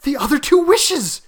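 high, loud, excited; feverishly eager, pitched high; rapid reply. A narrator's reading. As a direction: answer in an urgent, excited rush. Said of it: "she replied rapidly".

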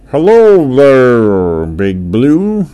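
loud, slow, drawn-out hello; orca's greeting. A narrator's reading. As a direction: boom out a big friendly sea-creature greeting. Orca greets the whale.

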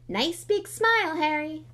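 high, slow, beaming showman grin; through gleaming teeth. Acted. Lockhart to Harry for the photographer, grinning through teeth. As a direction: smile through the teeth for the camera. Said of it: "said Lockhart through his own gleaming teeth".

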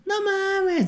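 high-pitched stunned exclamation; amazed. Retold or imitated. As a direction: squeal it out in disbelief. Amazed reaction to delicious micheladas.

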